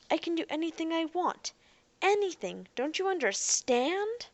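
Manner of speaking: high-pitched frustrated outburst; mild scream. A narrator's reading. Robot boy yelling at Ariel in frustration, mild scream. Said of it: "He was screaming now".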